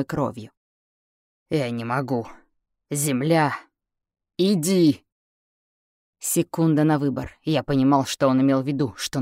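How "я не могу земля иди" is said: words torn from the throat; forcing each word out; strained voice. Acted. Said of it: "вырывать каждое слово из горла".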